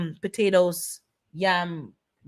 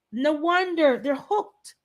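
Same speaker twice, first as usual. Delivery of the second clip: normal talking voice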